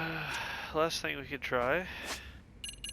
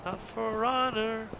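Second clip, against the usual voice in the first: high, slow heroic declaration; dramatic hero voice; 9 semitones higher, 3 dB louder, much slower than usual. Pretend. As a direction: deliver it like a dying hero. Quoting a dramatic line.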